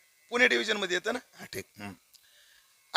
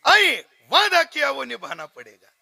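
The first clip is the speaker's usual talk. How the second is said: filmy dialogue; 6 semitones higher, 3 dB louder than usual. Acted. Son quotes Hindi film line.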